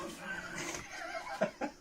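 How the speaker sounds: airy laugh up high; high breathy laugh. Real, not acted. Laughs after failure.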